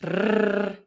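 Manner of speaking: a rolled tongue-tip rrr; alveolar trill. Shown as a demonstration. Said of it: "it's gonna sound like".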